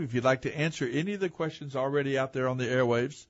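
ordinary talk

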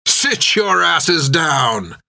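a slow, lightly voiced growl; a hint of a menacing sailor, slow and firm. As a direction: order them down like a sour bosun. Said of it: "growled Jack".

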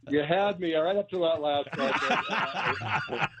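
slow, laughing through the words. Real, not acted. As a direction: laugh while you answer. Laughing at the teasing joke.

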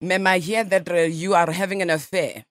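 a light mock Russian accent, nasal ma'am; radio prank caller in a lightly faked Russian accent; put-on Russian accent. Prankster calls a stranger as a Russian journalist, mock Russian accent. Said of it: "I speak Russian".

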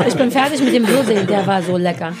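laughing with mouth full. Real, not acted. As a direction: talk through a bite of cake. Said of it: "gib mir den Kuchen wieder".

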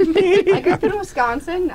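high, giggling through it; laugh-filled Wisconsin boast; laughing line. Real, not acted. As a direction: say it while laughing. Laughing while being teased.

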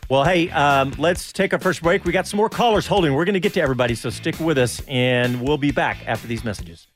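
ordinary delivery

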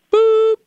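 high sung beep tone; beep sound. Pretend. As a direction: chirp a short machine beep. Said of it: "Please answer after the tone".

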